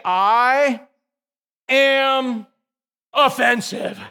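preacher leading the congregation in a chant; slow call and response. Shown as a demonstration. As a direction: lead the crowd word by word. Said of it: "Everybody say".

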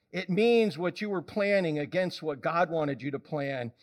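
ordinary delivery

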